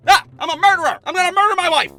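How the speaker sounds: loud, high hammed-up murderer yell; mock murderer. Husband bursting in to test the dog, hammed-up murderer yell. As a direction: burst in like a fake killer. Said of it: "my best murderer impersonation".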